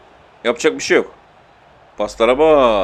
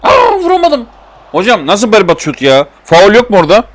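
very high, loud wail of frustration; frustrated yell; 6 semitones higher, 7 dB louder than usual. Real, not acted. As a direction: cry out at the ref after missing. Missed shot, appeals for foul.

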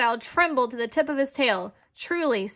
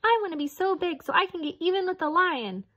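high squeaky mouse voice; high little mouse wishing; 8 semitones higher than usual. Acted. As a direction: squeak out a grudge. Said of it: "Then the mouse squeaked".

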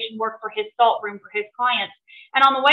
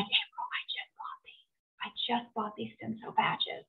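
near-whisper, much softer; very quiet, whispery aside; hushed retold confession; 18 dB quieter than usual. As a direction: drop to a hush as if barely able to say it. Retold dismayed reply on phone.

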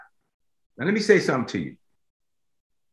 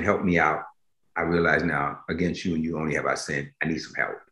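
much softer, lower, humbled prayer; 9 semitones lower, 7 dB quieter than usual. Acted. Preacher voicing a sinner crying out to God, soft and low. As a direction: pray it low and quiet.

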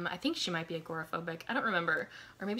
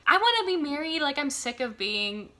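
high, loud acted-out plea, settling down; mock exasperated wail; fed-up whining; 8 semitones higher, 6 dB louder than usual. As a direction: complain with hammed-up longing. Acted complaint of single heroine.

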